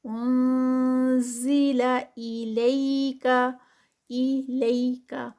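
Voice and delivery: انزیلا الیکا chanted slowly, لئی short; slow recitation. Shown as a demonstration. Teacher recites the phrase for pupils, slow chant. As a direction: draw out انزیلا الیکا, keeping لئی under two counts. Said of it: "یا لین ہے".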